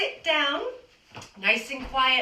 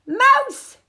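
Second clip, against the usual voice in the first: high, loud sudden yell; shout; 14 semitones higher, 11 dB louder than usual. A narrator's reading. Reading to children, shouting to wake the sleeping elephant. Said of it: "is to shout".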